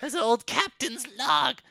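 put-on high, eager old crone; eager hag. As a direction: chirp it out as the hag.

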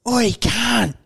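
high, drawn-out mock holler; calling out. Crackhead calling after two mates leaving the shop, high mock holler. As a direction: imitate an aggressive stranger hailing someone. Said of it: "by the third or fourth call".

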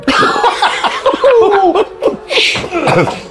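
long squealing laugh through the burn; high pained laugh. Real, not acted. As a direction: let a high laugh run out. Laughing at the hot wings.

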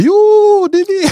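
very high, squeaky yes; hammed-up high-pitched reply; mock high answer. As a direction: squeak it back like a thrilled kid. Themselves voiced in story.

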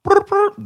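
very high rolled brrr twice; mock double ring, high; double ring imitation. Shown as a demonstration. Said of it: "if it goes ring".

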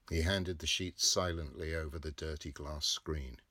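usual speaking voice

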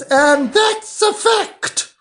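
put-on high, quavering old duchess; frail withering duchess. Duchess voiced.

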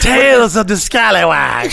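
high, booming title announcement; hammed-up TV intro voice; show announcer. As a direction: announce it like a horror show host.

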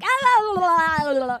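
high, very loud la-la gibberish; imitating a noisy, excited babbler; loud mock babbling. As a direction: blurt out singsong nonsense at full volume. Mimicking Ella's loud social babble.

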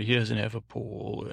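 normal talking voice

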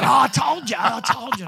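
high-pitched mock crowing; imitated gloating. Comic imitates a man proved right to his mates, gloating. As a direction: crow it like you've just won the bet.

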